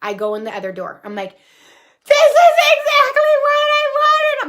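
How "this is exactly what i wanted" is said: high, tearful sob through the words, slowed; crying. Retold or imitated. Finding the dream house, sobbing with joy. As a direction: break down in happy tears at the dream house. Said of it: "I'm crying even more".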